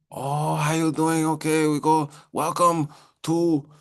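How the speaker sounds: put-on slow, high caricature of a Chinese accent; hammed-up Chinese-accented host greeting; drawn-out mock Chinese-accent welcome. Mocking how others would greet.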